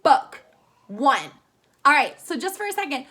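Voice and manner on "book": Book as one loud, isolated beat; one emphatic, separate Book; counted-out syllable. Shown as a demonstration. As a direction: punch Book out as a single pulse. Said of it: "did my mouth open".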